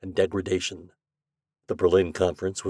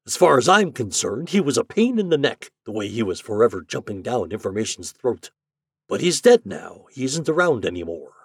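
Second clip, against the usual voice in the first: scornful boss; 6 semitones higher, 8 dB louder than usual. Acted. Hart dismisses dead man.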